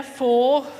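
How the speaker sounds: ordinary delivery, nothing marked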